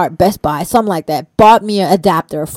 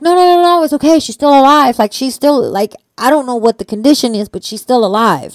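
much higher and louder urgent reassurance; insistent comforting, higher and louder; 5 semitones higher, 3 dB louder than usual. Retold or imitated. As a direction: rush to calm a crying friend. Calming crying friend urgently.

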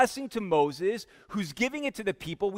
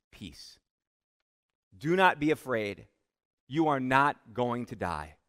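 hushed reassurance; 8 semitones lower than usual. Acted. God's reassuring words to Gideon.

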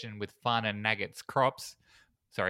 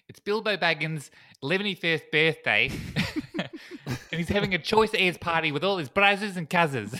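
raised, hammed-up Kiwi accent; bad New Zealand accent; 7 semitones higher, 6 dB louder than usual. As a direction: put on a broad, terrible New Zealand accent. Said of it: "I'll stop the terrible New Zealand thing".

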